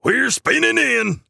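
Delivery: very high-pitched cry of alarm; Jingleman shouting. A narrator's reading. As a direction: shout a crash warning in a shrieky-high character voice. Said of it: "shouts Jingleman".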